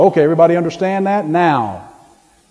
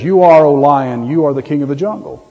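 ordinary delivery

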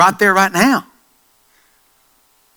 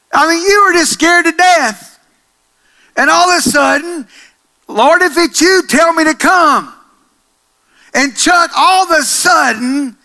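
loud, higher, fervent preacher voice; fervent preaching; 8 semitones higher, 5 dB louder than usual. Acted. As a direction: build it up from the pulpit. Preaching at full force.

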